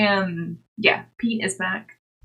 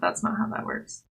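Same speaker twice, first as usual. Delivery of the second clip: half-voiced, softer aside to self; muttered under breath; 6 dB quieter than usual. Real, not acted. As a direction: drop to a near whisper on "that's not how that works". Restarts the same phrase, softer.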